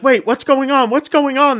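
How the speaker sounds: high, panicky retelling of frightened guests; imitating scared tourists, high and urgent; mock panic. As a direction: pitch it way up like they're freaking out. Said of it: "They're all freaking out".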